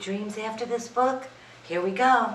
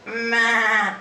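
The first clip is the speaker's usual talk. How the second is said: goat bleat; 3 semitones higher, 5 dB louder than usual. Acted. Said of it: "said Goat".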